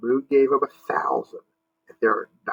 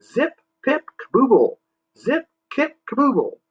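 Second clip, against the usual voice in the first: very high squeaky alien cheer; Mars men cheering; 7 semitones higher than usual. Acted. Mars men celebrating Jimmy, high squeaky cheer. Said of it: "the Mars men cheered".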